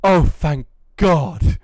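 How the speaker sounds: slow, sighing relief. Retold or imitated. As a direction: let it out on a long relieved sigh. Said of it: "the biggest sigh of relief".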